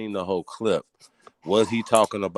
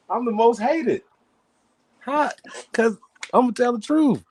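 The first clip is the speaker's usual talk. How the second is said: high-pitched exasperation; 12 semitones higher than usual. Acted. Man boasting he is the most hated, high-pitched exasperation. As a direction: squeal it like you can't believe this.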